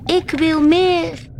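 high, drawn-out cry of dissatisfaction; disappointed cry. A narrator's reading. Whale after yet another find, crying out in disappointment. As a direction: call it out slowly, pitched well up. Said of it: "riep Wilbert teleurgesteld uit".

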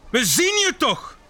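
high, indignant call; angry call. Acted. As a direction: call out crossly to someone hiding. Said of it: "roepen ze boos". (boos en hoog roepend)

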